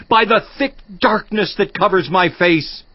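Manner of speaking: ordinary delivery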